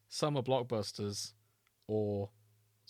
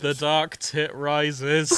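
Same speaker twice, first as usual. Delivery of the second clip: mock dramatic title; 2 semitones higher, 7 dB louder, much slower than usual. Savouring the collection's title.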